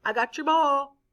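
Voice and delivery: high mock sing-song teasing; teacher voicing Pete taunting the kids, high. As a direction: tease them from across the field. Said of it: "taunting them".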